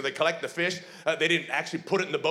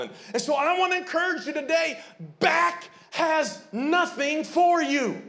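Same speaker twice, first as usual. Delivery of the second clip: preaching at full force; 8 semitones higher than usual. Acted. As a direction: drive the message home to the congregation.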